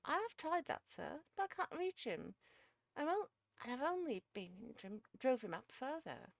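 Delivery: high, loud, protesting servant woman; exasperated cook Missus Beale. A narrator's reading. Missus Beale tells sir she has tried, exasperated.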